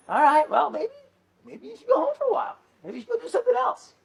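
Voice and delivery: high, fast playful voice; imitating a colleague. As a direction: mimic a chirpy coworker sending you home. Colleague advising go home.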